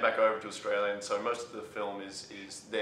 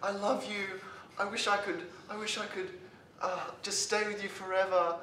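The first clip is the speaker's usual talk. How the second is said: mock girlfriend voice; 9 semitones higher than usual.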